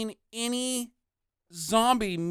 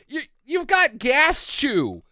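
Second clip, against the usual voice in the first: ordinary delivery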